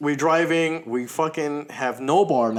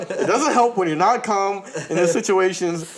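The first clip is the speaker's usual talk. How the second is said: ordinary delivery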